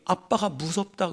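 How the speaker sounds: ordinary delivery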